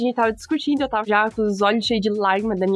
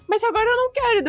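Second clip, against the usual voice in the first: much higher, hammed-up mom brushing it off; mock stubborn mom; 10 semitones higher than usual. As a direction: toss off the refusal like the mom. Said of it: "Aí ela". (imitando mãe teimosa, voz bem aguda)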